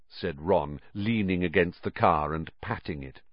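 ordinary delivery, nothing marked

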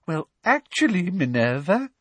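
high, sugary, affected voice; simpering. A narrator's reading. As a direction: contradict her with coy sweetness. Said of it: "simpered Professor Umbridge".

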